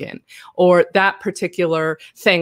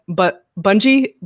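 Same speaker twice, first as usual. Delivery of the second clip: ordinary delivery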